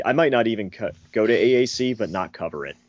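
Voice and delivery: usual speaking voice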